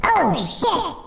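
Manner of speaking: high pitch-shifted voice. Shown as a demonstration. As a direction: voice run through a high pitch effect. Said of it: "It's a little too high".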